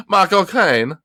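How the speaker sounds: put-on British accent. Host repeats the accent trick laughing, hammed-up British accent. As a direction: push the British accent high and silly. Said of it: "in a British accent".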